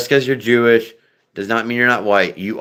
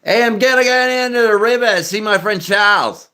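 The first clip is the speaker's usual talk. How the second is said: high, broad Australian accent with bent vowels; hammed-up Australian accent; 12 semitones higher than usual. Shown as a demonstration. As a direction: do a broad Aussie, pitched way up. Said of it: "let's switch over to Australian".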